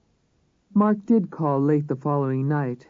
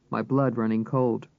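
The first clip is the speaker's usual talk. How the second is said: usual speaking voice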